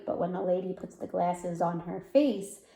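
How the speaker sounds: ordinary delivery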